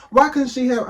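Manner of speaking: ordinary talk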